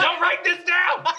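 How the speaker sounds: high, slow laughing yell; laughing shout. Real, not acted.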